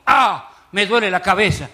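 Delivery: loud "ah", then mock complaint of pain; feigned headache. Girl dodging her blind date, loud fake headache. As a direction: cry out as if struck by a sudden headache. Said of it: "La mina dijo".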